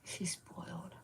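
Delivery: soft whispered aside. Real, not acted. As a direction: let it out as a fond secret.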